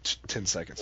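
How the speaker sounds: usual speaking voice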